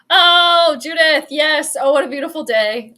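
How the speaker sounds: loud, high burst of delight, easing off; joyful outcry, gradually settling; elated. Real, not acted. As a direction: celebrate long-awaited good news. Celebrating that Kirk is gone.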